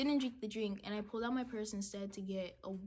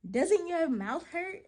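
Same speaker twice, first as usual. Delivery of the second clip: high, loud, slow disbelief; incredulous; 7 semitones higher, 9 dB louder, slower than usual. Retold or imitated. Retelling her thought to the man she bumped, in loud disbelief. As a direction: ask it like you can't believe he's fine.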